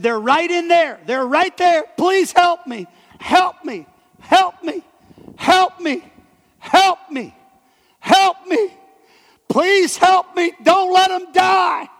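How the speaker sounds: high, loud, frantic begging, slowing at the end; desperate screaming plea. Retold or imitated. Young man screaming at the preacher to save his wife and boy, frantic scream. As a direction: scream for help as if your family is trapped inside. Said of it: "This guy's standing there screaming at me".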